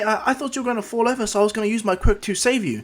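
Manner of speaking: put-on high-pitched, flustered girl voice; high, hesitant schoolgirl character. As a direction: stammer it out high, like a startled girl. Flustered girl meets the hero.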